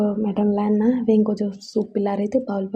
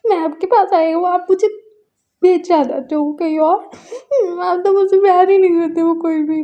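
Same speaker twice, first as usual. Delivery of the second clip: high, loud tearful plea; crying accusation; 8 semitones higher, 6 dB louder than usual. Acted. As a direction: accuse her through sobs. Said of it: "बोल के वो रो रहा था". (ऊँची आवाज़ में रोते हुए शिकायत)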